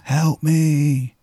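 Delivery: a hint of a pleading painting; mouthed scream. A narrator's reading. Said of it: "it's like it's screaming at you".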